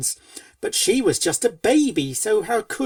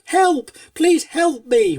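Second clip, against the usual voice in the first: loud desperate holler; a frightened colt calling for help; 8 semitones higher, 6 dB louder than usual. A narrator's reading. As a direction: yell it out in panic. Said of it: "He hollered".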